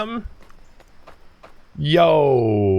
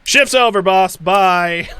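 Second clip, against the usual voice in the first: higher, louder breezy sign-off; cheeky mock farewell; 8 semitones higher, 5 dB louder, slower than usual. Imitating a coworker quitting, breezy and cheeky. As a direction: toss it off like walking out mid-shift. Voicing coworker walking off job.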